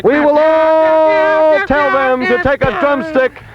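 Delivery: high, drawn-out orator's proclamation; mock rally speech. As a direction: address the troops before a grand mission, stretching words.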